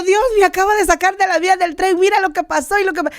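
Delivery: high, rushing frantic retelling; like a madwoman. Retold or imitated. Sister telling brother God saved her, frantic excitement. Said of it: "yo parecía una loca contándole".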